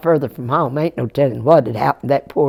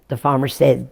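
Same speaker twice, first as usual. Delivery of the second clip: plain reading voice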